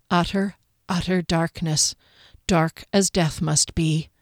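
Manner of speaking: plain reading voice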